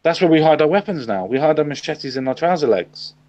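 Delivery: normal talking voice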